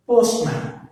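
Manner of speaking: "Postman" with POST high and firm; first-syllable stress. Shown as a demonstration. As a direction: hit "post", let "man" fall. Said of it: "stress falls on the".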